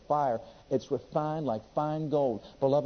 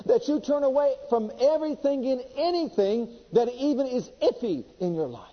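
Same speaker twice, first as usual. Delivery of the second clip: fiery preaching; 8 semitones higher than usual. Acted. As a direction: preach it hot to the congregation. Preaching at full force.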